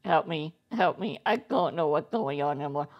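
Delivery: low, soft helpless plea; quiet plea. Acted. Sat on by the other player, a quiet plea. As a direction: murmur it defeated.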